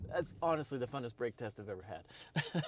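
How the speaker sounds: words through a real laugh; real laughter after a fun test; laughing while talking. Real, not acted. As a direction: still giggling from the thrill.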